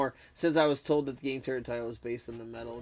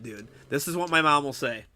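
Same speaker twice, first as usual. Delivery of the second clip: ordinary delivery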